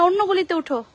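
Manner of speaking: ordinary talk, nothing marked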